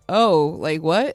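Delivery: loud, startled oh what; loud surprised quote. Retold or imitated. Clerk on the phone, startled and loud. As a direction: blurt it out in surprise.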